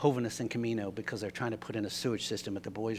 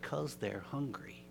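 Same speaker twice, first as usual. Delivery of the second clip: much softer, slow and plain; soft simple answer; 10 dB quieter, slower than usual. Retold or imitated. Dudley quietly answering the angry driver, soft and slow. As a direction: say it gently, no fuss. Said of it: "Dudley simply said".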